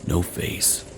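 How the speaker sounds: ordinary delivery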